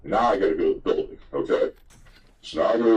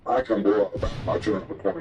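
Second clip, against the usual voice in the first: ordinary delivery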